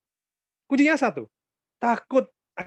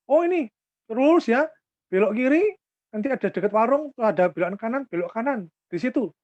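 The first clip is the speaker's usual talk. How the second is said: higher-pitched imitation of someone giving directions; helpful local youth, lightly voiced; 6 semitones higher, 5 dB louder than usual. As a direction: eagerly point out the route, step by step.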